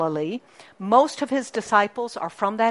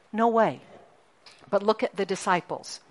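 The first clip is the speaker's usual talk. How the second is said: ordinary delivery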